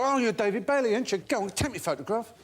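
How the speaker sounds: put-on high drunken cockney pestering; drunk fan. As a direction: wheedle like a tipsy fan. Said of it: "And they go".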